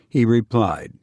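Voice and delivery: usual speaking voice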